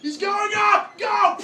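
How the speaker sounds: high, loud urgent yell; urgent shout. Acted. Character in an imagined movie trailer, high urgent shout. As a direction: shout it like an action-movie line.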